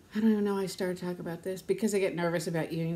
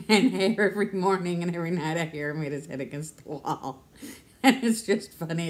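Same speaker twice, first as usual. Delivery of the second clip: laughing; 5 dB louder than usual. Real, not acted. Laughing about grandson.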